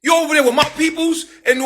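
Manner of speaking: high slowed shout of outrage; outraged. Retold or imitated. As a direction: yell it out in disbelief. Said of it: "I'm like".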